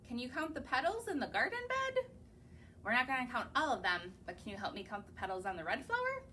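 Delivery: ordinary delivery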